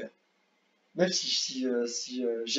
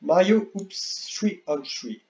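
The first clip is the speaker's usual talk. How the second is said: put-on slow, drawn-out English accent on the game title, pitched higher; mock English accent; 5 semitones higher, much slower than usual. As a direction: announce the title like a hammed-up English game announcer. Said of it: "Mon accent anglais est merveilleux".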